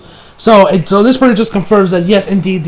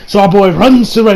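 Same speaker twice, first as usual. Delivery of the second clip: ordinary talk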